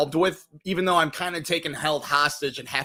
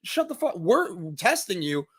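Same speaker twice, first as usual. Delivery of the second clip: voicing the fed-up friend; 6 semitones higher than usual. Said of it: "that friend is like".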